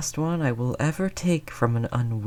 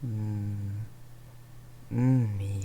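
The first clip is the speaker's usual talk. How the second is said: normal talking voice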